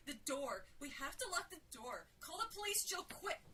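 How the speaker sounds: panicked shout. A narrator's reading. Sarah urging Jill to lock the door, panicked shout. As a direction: call out orders in rising panic.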